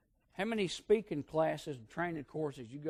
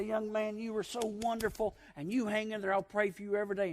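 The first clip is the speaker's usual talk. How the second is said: lightly voiced, higher tender old lady's blessing; loving old aunt; 6 semitones higher, 3 dB louder than usual. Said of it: "She say".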